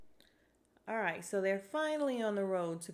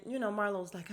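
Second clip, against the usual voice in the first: usual speaking voice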